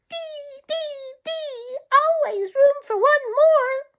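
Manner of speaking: very high little bird voice, eager; a tiny bird spotting food; chickadee voice. Acted. Tommy Tit welcomes Sammy.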